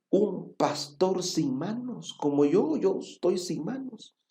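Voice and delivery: put-on awed child almost whispering; amazed child. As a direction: breathe it out in quiet wonder like a small boy. Child amazed at handless pastor.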